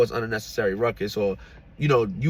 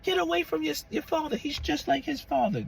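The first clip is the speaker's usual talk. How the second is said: high mock voice of the girlfriend; 4 dB quieter than usual. Girlfriend telling their son to stay away from his father, high mock voice. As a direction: pitch her up high and snippy.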